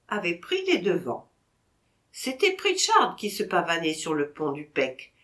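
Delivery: usual speaking voice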